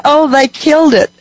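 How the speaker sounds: high-pitched Southern woman, lightly imitated; southern accent. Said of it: "she had a southern accent".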